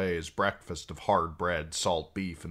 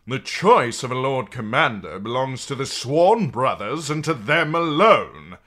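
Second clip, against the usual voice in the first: louder, higher firm insistence; insistent; 7 semitones higher, 11 dB louder than usual. A narrator's reading. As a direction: insist on your rights. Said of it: "insisted Sir Dennis Mallister".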